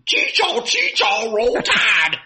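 high, loud whooping football chant; Alabama fan yell. Retold or imitated. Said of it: "That's what they'd say".

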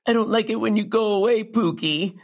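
mock-needy pleading with a pet name; needy girlfriend. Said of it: "the Earth's needy girlfriend".

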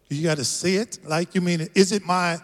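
usual speaking voice

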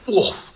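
short high mock roar, woof; bear roar imitation. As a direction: bark it out like the bear. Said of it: "every time that bear roars".

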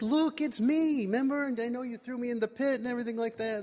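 high-pitched, eager insistence; excited reassurance. Retold or imitated. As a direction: convince them with voice soaring. Joseph convinces frightened brothers.

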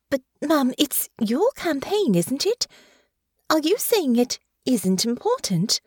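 confused and off balance; unsettled young lady, a touch pleading; flustered Honor. Acted.

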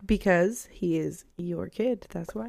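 soft, measured comeback; quiet deadpan. Real, not acted. Deadpan jab at co-host.

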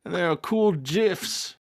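put-on high old grandpa character voice; chirpy old man showing off. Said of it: "Grandpa Dan".

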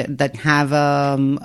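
usual speaking voice

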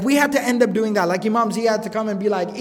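normal talking voice

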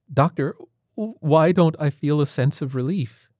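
high, tense patient voice; nervous patient voice. Acted. Rawlings's strained patient voice.

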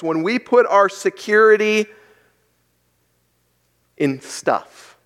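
normal talking voice

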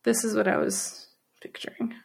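hushed whisper. Real, not acted. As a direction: whisper it, spooked. Hushed while showing scary photo.

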